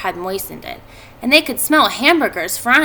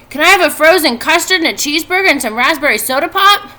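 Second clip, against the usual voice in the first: put-on excited child; 7 semitones higher, 6 dB louder than usual. A narrator's reading. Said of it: "asked Avery".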